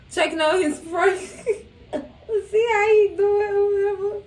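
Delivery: high-pitched talk through real laughter; words breaking into a laugh. Real, not acted. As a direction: crack up while talking. Cracks up mid-story.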